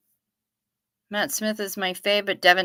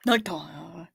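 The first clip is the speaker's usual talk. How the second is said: Nighttime! whispered, low and soft; 4 semitones lower, 4 dB quieter than usual. Pretend. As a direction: breathe Nighttime! as a hushed whisper. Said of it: "it's the nighttime, daytime one".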